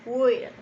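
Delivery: "Will" with the I toward E and a strong L; "Will" said firmly, held L, I leaning to E; careful will. Shown as a demonstration. Teacher modeling "will" for students. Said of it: "que la I se pronuncie un poco como E".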